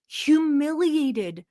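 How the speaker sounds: drawn-out mock. Real, not acted. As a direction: stretch the word out slowly, savouring it. Drawn-out mocking emphasis on clip.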